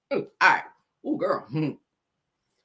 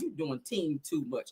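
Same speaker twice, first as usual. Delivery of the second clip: usual speaking voice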